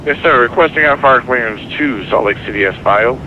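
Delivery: air traffic control radio. Real, not acted. Pilot reading on radio frequency.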